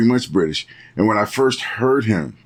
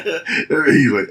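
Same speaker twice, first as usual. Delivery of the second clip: ordinary talk, nothing marked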